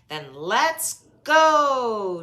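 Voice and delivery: very loud, slow, hearty cry; Daddy Pig rallying the family off in the car; shouted. A narrator's reading. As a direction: bellow it like a cheery dad. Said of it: "shouted Daddy Pig".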